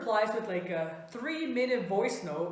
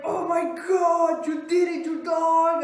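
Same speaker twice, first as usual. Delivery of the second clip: excited friend; 6 semitones higher, 4 dB louder than usual. Retold or imitated. Friend congratulating the teller, loudly excited. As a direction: imitate a thrilled pal cheering.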